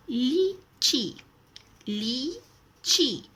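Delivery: लीची drawn out very slowly, long ई held; teacher modelling लीची slowly; careful pronunciation. Shown as a demonstration. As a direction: stretch लीची out slowly for the class. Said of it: "इसका उच्चारण करना है".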